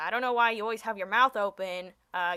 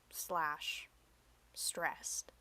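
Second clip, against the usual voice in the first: whisper; 4 semitones lower, 12 dB quieter than usual. Acted.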